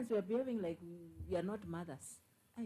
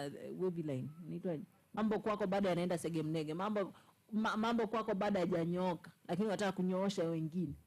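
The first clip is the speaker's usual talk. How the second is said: ordinary talk, nothing marked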